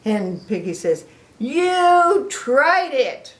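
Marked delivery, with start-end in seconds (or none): 1.4-3.3 high, loud, very slow delight; thrilled pig. Acted. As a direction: celebrate it. Piggy thrilled.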